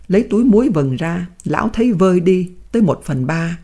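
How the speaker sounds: ordinary delivery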